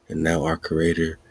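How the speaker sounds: ordinary delivery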